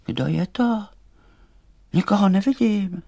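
lightly voiced high little doll; high-pitched puzzled question. A narrator's reading. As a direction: ask into the dark in a high voice. Doll answers stranger in the dark.

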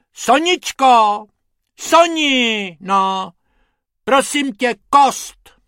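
high, loud coaxing call. Acted. Hippo asking Sonička for the bone, calling out. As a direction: call across for a prop. Hippo calls his assistant for prop.

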